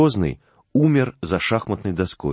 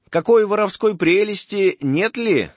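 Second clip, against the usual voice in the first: suspicious merchant; 9 semitones higher than usual. Acted.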